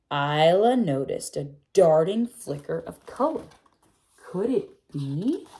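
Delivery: put-on gentle read-aloud for children, telling the tale; storybook reading. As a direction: read it like a bedtime picture book. Reading picture book aloud.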